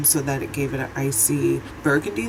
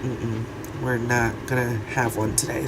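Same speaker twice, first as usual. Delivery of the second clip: low croaky mock voice; rusty unused voice; 4 semitones lower, 5 dB quieter than usual. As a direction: croak it like a broken voice. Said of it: "my voice is like".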